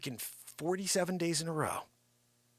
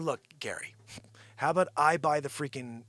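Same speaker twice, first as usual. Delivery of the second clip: usual speaking voice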